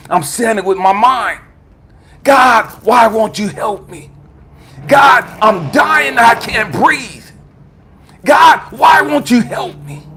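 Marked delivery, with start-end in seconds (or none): 2.2-10.1 drawn-out, raised cry for help; desperate plea to God. Retold or imitated. Dying man begs God for help, desperate plea. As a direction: plead slowly and forcefully, voice lifted.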